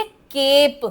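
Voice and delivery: केप with a long, stretched "ay"; lengthened vowel. Shown as a demonstration. Teacher shows how silent E works, lengthened vowel. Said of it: "pronounce करते हुए बढ़ा देते हैं".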